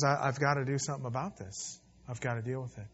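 soft, sorrowful, falling away; quietly, heavy with grief; a touch of grieving God. Retold or imitated. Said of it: "as God is in grief".

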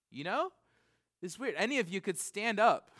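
ordinary talk

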